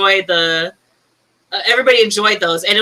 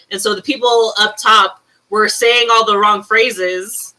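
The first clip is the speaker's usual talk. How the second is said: ordinary talk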